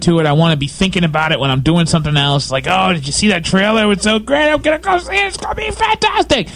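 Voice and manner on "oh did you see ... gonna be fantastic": gushing, climbing into a very high squeal; hyped-up fan. Retold or imitated. Fan looking forward to the movie, hammed-up squealing excitement. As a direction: build from eager chatter to falsetto glee.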